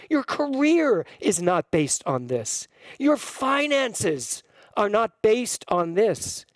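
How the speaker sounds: high-pitched, impassioned declaration; slow, emphatic preaching carried by feeling; real emotion. Real, not acted. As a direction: speak from a swell of conviction, drawing out each statement. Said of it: "I'm getting all emotional".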